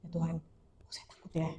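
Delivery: hushed, near-whispered prayer; private anxious prayer. Acted. Praying alone in the bathroom when afraid, hushed private prayer. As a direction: pray softly under your breath, a touch of fear. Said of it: "saya masuk kamar mandi".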